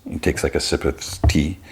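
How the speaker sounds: usual speaking voice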